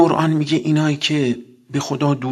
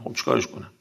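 a breathy, voiceless aside, much softer; whispered aside; 10 dB quieter, faster than usual. Shown as a demonstration. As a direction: whisper "خب چیکارش کنم؟" like a shrug to yourself. Shrugging aside a dietary demand.

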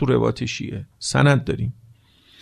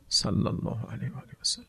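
whispered blessing; 3 semitones lower, 13 dB quieter than usual. Real, not acted.

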